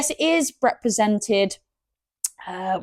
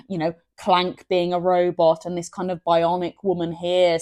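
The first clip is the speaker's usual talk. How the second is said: ordinary delivery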